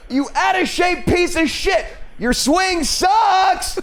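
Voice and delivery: high, laughing yelled insults; shouting insults. Acted. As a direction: heckle them out the window. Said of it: "start calling those guys assholes".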